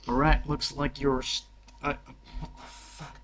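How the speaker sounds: ordinary talk, nothing marked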